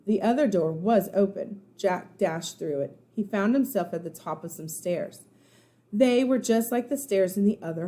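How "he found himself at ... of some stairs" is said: usual speaking voice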